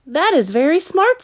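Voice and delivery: put-on small boy's voice, high and eager; young Caleb. A narrator's reading. Caleb asking his sister, high child voice.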